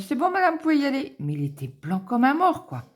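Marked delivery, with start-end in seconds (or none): 0.0-1.1 high, loud imitation of the doctor; doctor's voice. Doctor calling the wife in after the shot, breezy. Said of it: "il m'a dit".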